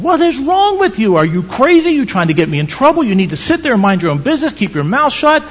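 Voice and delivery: furious, high and loud shouting at his wife; hammed-up angry husband yelling; infuriated husband. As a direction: snap at her like a husband losing his temper. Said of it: "the husband becomes infuriated".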